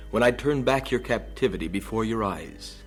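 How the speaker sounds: usual speaking voice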